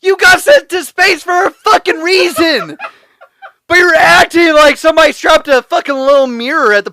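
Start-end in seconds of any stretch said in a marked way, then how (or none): 0.0-2.8 high, slow, loud pleading yell; yelling at a character who should know better; exasperated shout. Retold or imitated. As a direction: shout it at the screen half laughing. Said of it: "I was like".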